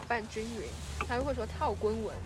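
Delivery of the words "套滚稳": put-on Cantonese-accented Mandarin. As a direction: say it like a Cantonese cook fumbling Mandarin. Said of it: "但讲出来就是广普". (广普口音)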